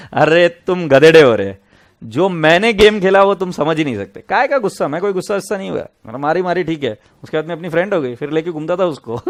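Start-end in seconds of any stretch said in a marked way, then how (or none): 0.1-5.7 loud teasing. Real, not acted. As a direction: loudly scold them in fun. Teases kids, laughing loudly.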